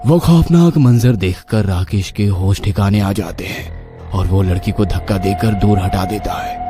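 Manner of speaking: ordinary delivery, nothing marked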